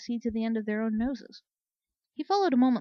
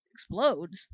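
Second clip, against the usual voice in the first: flat disbelief; 4 dB louder than usual. Acted. Second soldier echoing the news, stunned and falling. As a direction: let "Explodes?" drop flat in dull shock.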